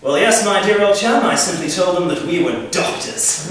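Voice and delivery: mock upper-class English chum; upper-class accent. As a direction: put on a posh accent, slowing at the end. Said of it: "somewhat upper-class friend".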